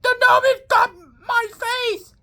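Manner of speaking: squealing high-pitched cry for help; high panicked yell. Acted. Soldier yelling as a gnome attacks his face, high panicked shout. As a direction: shriek it like a gnome is clawing your face. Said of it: "and yell".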